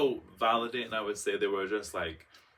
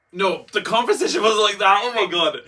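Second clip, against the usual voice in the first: loud, high, laughing protest; loud excited correction; excited outburst; 11 semitones higher, 13 dB louder than usual. Real, not acted. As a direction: burst out that it wasn't like that. Correcting her own retelling.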